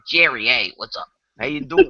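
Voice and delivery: quick New York street greeting; New York accent. Shown as a demonstration. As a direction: greet him like a New Yorker. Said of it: "in New York".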